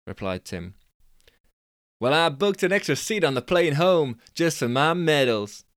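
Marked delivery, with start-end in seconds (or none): 2.0-5.6 Californian dude. Acted. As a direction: squeak up the pitch like a cocky Californian. Billy voiced.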